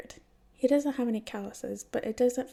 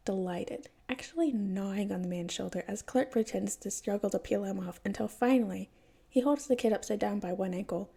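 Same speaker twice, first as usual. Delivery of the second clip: ordinary delivery, nothing marked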